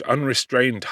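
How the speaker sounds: plain reading voice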